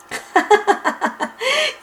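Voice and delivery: high mischievous giggle; sly giggle. Acted. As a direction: laugh at the fooled friends. Bibi laughs at tricked friends. (petit rire malicieux)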